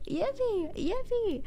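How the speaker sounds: excited cheer. Real, not acted. Chat asked for a yippee.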